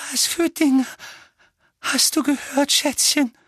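high-pitched, fearful doting mother; breathed, tearful mother. A narrator's reading. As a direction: ask your darling haltingly, close to tears. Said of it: "hauchte Tante Petunia".

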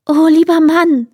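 high, loud alarmed wife voice; wife calling out. A narrator's reading. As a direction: cry out to her husband in dismay. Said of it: "rief die Frau". (hoch und laut erschrocken rufende Igelfrau)

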